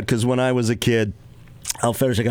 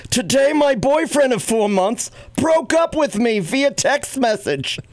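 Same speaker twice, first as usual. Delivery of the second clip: higher-pitched, slow New York mom reading; a Reader's Digest story read in mom's New York voice; mom's New York accent; 9 semitones higher than usual. Acted. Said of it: "as my mom would read 'em".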